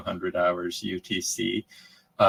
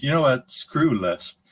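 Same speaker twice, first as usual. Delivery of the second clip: exasperated; 5 semitones higher, 5 dB louder than usual. Retold or imitated. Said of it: "exasperated Emacs themed".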